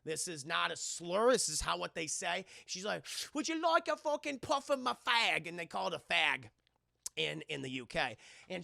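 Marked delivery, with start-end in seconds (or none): 3.3-5.5 high, lightly put-on British schoolgirl; mock British girl. Schoolgirl offering her friend a cigarette, mock British lilt. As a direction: offer the puff in a cheeky posh lilt.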